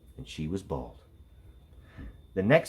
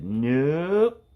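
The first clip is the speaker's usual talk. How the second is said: a flat refusal, louder and higher; the little girl refusing outright; bratty nope; 6 semitones higher, 6 dB louder than usual. A narrator's reading. Stephanie refusing each ponytail offer, short stubborn nope. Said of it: "Stephanie said".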